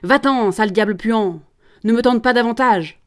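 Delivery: cried-out command. Acted. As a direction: shout the devil away indignantly. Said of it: "Il fallait lui crier". (crier pour chasser le diable)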